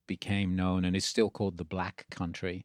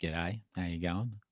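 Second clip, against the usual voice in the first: Australian accent; 7 dB quieter than usual. Shown as a demonstration. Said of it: "But then I spent some time in Australia".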